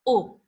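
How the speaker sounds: "U" short, lips pushed quickly forward; short damma u. Shown as a demonstration. Teacher demonstrating the damma vowel to students, short rounded u. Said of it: "our mouth goes shortly to the front".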